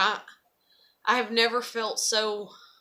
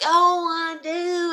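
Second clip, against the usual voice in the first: mocking his reply; 7 semitones higher, 5 dB louder, much slower than usual. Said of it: "And then it's like".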